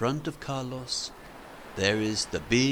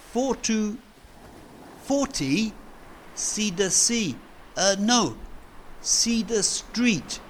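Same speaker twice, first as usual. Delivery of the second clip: much higher, halting learner's voice; slow broken English; 10 semitones higher than usual. Acted. Carlos asking Fred for help, halting higher learner voice. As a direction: grope for each English word. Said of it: "He says slowly".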